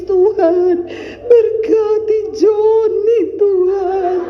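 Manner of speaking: high, drawn-out mock wail; mock tearful prayer. Preacher mimicking a prayer for someone's husband, mock crying. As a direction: pray as if weeping, hammed up and slow. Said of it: "saya doa nangis".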